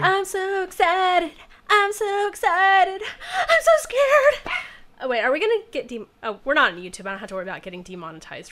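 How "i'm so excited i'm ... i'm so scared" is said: high, slow, loud hysterical sobbing-edge wail; Jesse Spano freak out. Acted. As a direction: panic breaking into a shrill wail. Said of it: "my Saved by the Bell Jesse Spano freak out".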